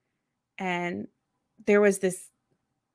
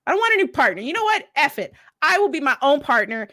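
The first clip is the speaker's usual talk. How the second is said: higher and louder, fed-up outburst; retold outburst; 5 semitones higher, 4 dB louder, faster than usual. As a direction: storm off from the partner, voice rising. Retold blowup at lab partner.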